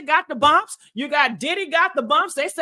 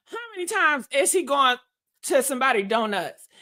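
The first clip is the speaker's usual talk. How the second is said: ordinary delivery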